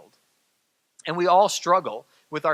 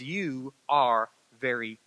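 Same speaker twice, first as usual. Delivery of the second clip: tender reassurance; 7 semitones lower, 4 dB quieter, slower than usual. Acted. God's words to listeners.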